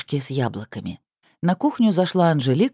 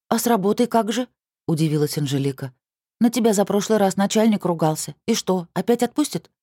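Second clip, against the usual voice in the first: put-on higher, playful teenage girl; teasing teen girl; 6 semitones higher than usual. A narrator's reading. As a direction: tease like a cheeky niece. Niece voiced.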